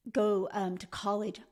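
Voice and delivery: ordinary delivery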